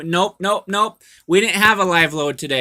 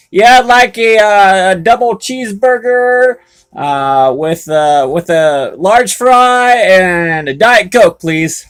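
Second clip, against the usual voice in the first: joking drive-thru order; 4 semitones higher, 7 dB louder, slower than usual. Retold or imitated. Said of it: "He's like".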